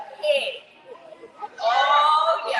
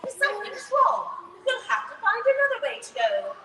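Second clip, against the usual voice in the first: Mummy Pig voice; 8 semitones higher than usual. Acted.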